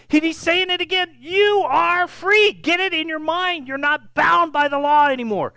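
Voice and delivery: high, forceful pulpit proclamation; impassioned preaching. Real, not acted. Preacher urging the congregation to grasp their freedom, impassioned preaching.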